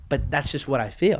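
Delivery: usual speaking voice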